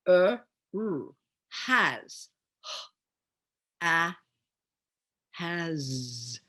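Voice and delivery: HAS broken into "h", "a", "z"; each sound of HAS said separately, with pauses. Shown as a demonstration. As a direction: sound out "has" in isolated phonemes. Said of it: "do a demonstration of higher level reading".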